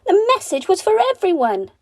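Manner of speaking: high, loud sing-song bird call; bird messengers. Acted. Carey birds announcing their message to the village, high chirpy call. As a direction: chirp the rhyme out like a bright little bird.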